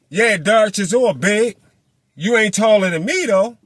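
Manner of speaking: high, loud imagined boast. Retold or imitated. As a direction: say it loud enough for him to hear. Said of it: "said that shit out loud".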